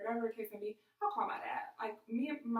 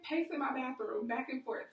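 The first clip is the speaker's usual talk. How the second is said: ordinary talk, nothing marked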